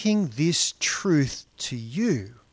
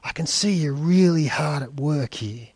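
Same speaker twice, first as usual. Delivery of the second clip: mock-gentle, ironic boss; sweet on the surface, cutting underneath; quiet, faintly sneering kindness; calm sarcasm; 2 semitones lower, 4 dB quieter, faster than usual. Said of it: "says calmly".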